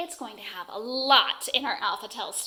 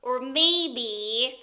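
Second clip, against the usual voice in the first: ordinary talk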